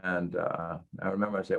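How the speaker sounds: normal talking voice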